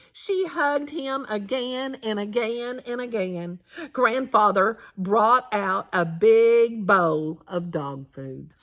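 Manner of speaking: ordinary delivery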